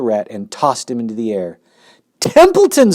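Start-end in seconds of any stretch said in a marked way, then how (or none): 2.2-2.9 very high, loud scream of the name. Acted. Pig screams to wake the sleeping rat. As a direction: shriek to wake him. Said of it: "screamed Wilbur".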